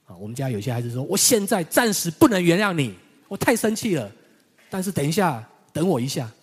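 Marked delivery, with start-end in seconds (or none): 1.0-6.3 put-on a child's indignant protest, high and loud; upset child. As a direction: play the sulking kid who is too angry to forgive yet. Child refusing to forgive yet. (孩子气的生气抗议，又高又响)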